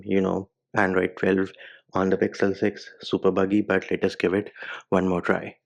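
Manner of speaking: normal talking voice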